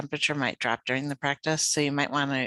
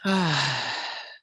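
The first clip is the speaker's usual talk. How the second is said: long, falling open "ah" sigh; long exhaled sigh. Shown as a demonstration. Meditation teacher showing listeners how to exhale, relaxed sigh. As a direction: let the breath out in one slow sigh. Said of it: "sigh as you exhale".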